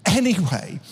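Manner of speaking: normal talking voice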